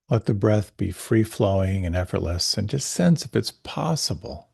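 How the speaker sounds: calm, unhurried meditation voice; guided meditation. Acted. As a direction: guide the listener gently inward.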